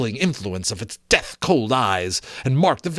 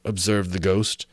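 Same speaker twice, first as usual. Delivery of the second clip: ordinary delivery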